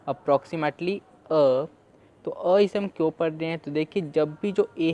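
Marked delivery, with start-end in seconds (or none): 0.1-1.7 approximately drawn out very slowly, then अ; slow sounding out. Shown as a demonstration. Teacher isolating the first sound slowly. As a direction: stretch approximately, land on अ. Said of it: "इसे हम पढ़ रहे हैं अ".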